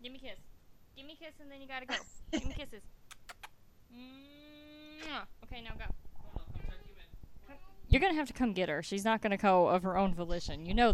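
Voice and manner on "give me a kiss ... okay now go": hushed, tender goodnight voice; soft voice. Real, not acted. Mother kissing child goodnight.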